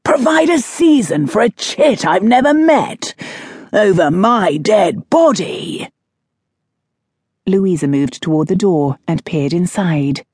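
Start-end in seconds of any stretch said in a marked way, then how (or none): 0.0-5.9 bellowing. Acted. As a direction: roar it from the next room. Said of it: "a voice bellowed".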